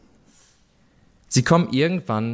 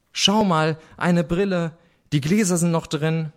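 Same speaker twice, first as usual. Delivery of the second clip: put-on higher-pitched excited child's call; sister calling out; 6 semitones higher than usual. A narrator's reading. Sister shows her siblings a find in the mud, calling out. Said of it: "rief meine Schwester".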